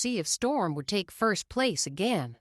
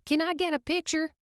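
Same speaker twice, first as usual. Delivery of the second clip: quick call out; 10 semitones higher, faster than usual. A narrator's reading. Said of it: "A reporter called".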